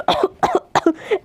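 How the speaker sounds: put-on throat-clearing coughs; fake awkward cough. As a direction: cough pointedly to cover embarrassment. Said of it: "ऐसे खास खास के". (झेंपकर बनावटी खाँसी)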